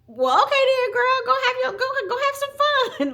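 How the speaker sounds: put-on high, loud, sassy kid granting permission; sassy little girl. Daughter tells her mother to go have fun, sassy high child voice. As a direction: play the daughter suddenly grown-up and sassy.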